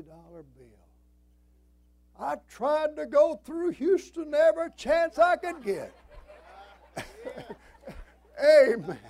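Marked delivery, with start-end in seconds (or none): 2.2-5.9 high, loud preacher's holler; exuberant punchline shout. Real, not acted. Punchline of fifty dollar story. 6.0-8.0 high, breathless laugh; real laugh. Real, not acted. Laughs at own punchline. 8.4-9.0 high, very loud shout; exultant amen. Real, not acted. Exults after his punchline.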